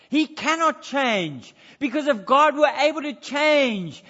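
loud, high preaching with big emphasis; full-force preaching. Acted. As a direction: preach it out to the congregation, forceful and rising.

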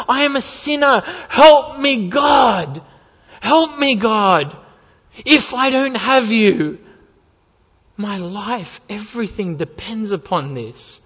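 loud, high pleading shout; desperate cry to God. Retold or imitated. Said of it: "in terror you realize".

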